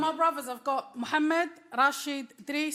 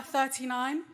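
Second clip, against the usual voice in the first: ordinary delivery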